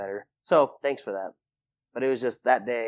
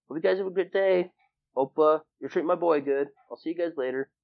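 higher, louder friendly dad farewell; lightly voiced dad calling goodbye to kids; imitating his dad; 8 semitones higher, 4 dB louder than usual. Dad bidding his son's classmates goodbye, imitated high and hearty.

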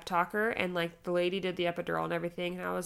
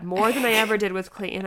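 normal talking voice

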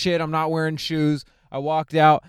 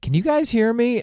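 calling out; 10 semitones higher than usual. Retold or imitated. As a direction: call out to the crowd, checking they can hear. Calling into a dead mic.

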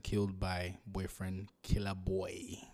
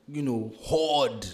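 hoard drawn out slowly and a bit louder; hesitant stress on hoard; 5 semitones higher, 6 dB louder, much slower than usual. Real, not acted. Host reluctantly choosing a loaded word, slow stress on hoard. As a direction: lean on hoard as a word you almost refuse to use. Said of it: "I don't want to use that word".

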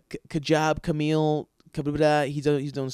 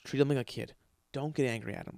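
softer, low-key director agreeing; hushed approval; 2 semitones lower, 7 dB quieter than usual. Retold or imitated. Director quietly approving the idea. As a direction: keep it quiet and encouraging. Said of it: "He's like".

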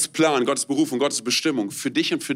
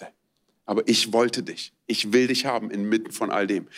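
God speaking tenderly; 7 semitones lower than usual. Acted. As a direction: speak as a loving father to his child. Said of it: "er sagt".

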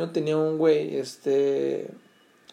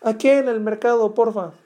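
lady's higher voice; 7 semitones higher, 5 dB louder than usual. Retold or imitated. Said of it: "A una señora".

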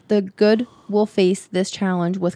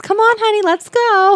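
put-on high, chirpy coaxing to a child; sing-song coaxing a kid along; eager parent voice; 13 semitones higher than usual. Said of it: "That would be me".

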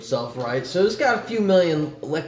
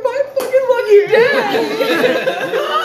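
mock sobbing sailor; 4 dB louder, much slower than usual.

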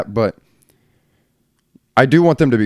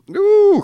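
burp ooh. Real, not acted. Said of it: "coffee got me burping".

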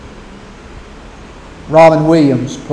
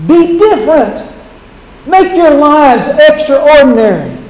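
much higher, louder exhortation; impassioned cry; 10 semitones higher, 5 dB louder than usual. Real, not acted. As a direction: urge them with rising passion. Preacher urges congregation.

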